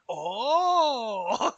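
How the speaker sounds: surprised yelp. Retold or imitated. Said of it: "And Ito was just like".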